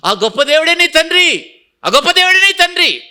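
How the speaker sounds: building preacher's shout; fervent preaching. Acted. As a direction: proclaim it to the congregation. Preaching at full force.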